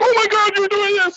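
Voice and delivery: very high, gushing mock fan; hammed-up high exclamation; imitated excitement. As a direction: squeal it like an amazed friend. Imitates everyone's excited reaction.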